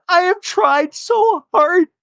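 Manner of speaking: high, slow, hammed-up sobbing plea; mock crying. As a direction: whimper it like a fake sob. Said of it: "Alexis is crying, going".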